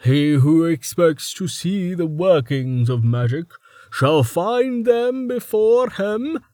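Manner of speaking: mock the old sage's pompous tone; imitating Reason. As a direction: Starvo mocking Reason's solemn saying. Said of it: "did a passable imitation of Reason".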